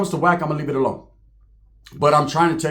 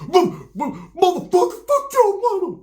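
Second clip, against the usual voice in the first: mocking a furious rival; 13 semitones higher, slower than usual. Mocking the rival who lost it over Jay Diggs, screeching high mock rage. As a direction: sputter it out like a man losing it, much higher. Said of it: "He went".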